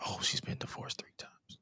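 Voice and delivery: whispered aside, barely any voice; hushed realisation. Retold or imitated. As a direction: whisper it like a scandalous discovery. Hushed reaction to an online search.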